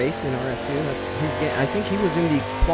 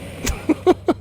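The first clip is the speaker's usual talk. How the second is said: amused laugh. Real, not acted. Laughs at skid marks.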